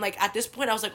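ordinary delivery

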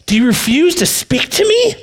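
high, indignant Pilate questioning; Pilate's voice. Acted. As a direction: press Jesus as a haughty governor.

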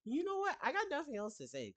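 high-pitched retold retort; mock exasperated comeback. Caller answering the yelling sister, mock exasperated. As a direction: start very high, as if fed up, then ease down.

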